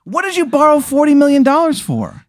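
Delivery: very high-pitched disbelief; incredulous outburst. Acted. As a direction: demand an explanation in a high squeal. Acted disbelief at the debt.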